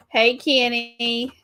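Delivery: ordinary delivery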